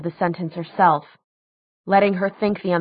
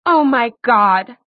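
slow, louder, higher exclamation; shocked outcry; 5 semitones higher, 6 dB louder, slower than usual. A narrator's reading. Daughter learns who her father is, shocked. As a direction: cry it out in shock.

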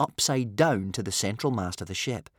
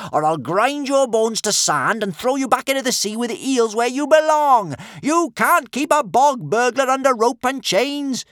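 high, loud shouted tirade of insults; defiant shout; 14 semitones higher, 8 dB louder than usual. A narrator's reading. Tied-up Kamikaze to the brutes, high defiant shouting. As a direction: yell threats at your captors at full pitch. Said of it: "shouted Kamikaze".

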